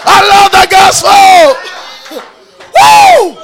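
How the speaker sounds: drawn-out high, loud holler; exultant cry of joy; ecstatic shout. Real, not acted. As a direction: shout it slowly to the rafters, then whoop. Preacher exults over the gospel.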